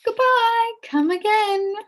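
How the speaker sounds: high, loud goodbye; shouted farewell. A narrator's reading. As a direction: call it after them as they go. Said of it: "shouted the witch".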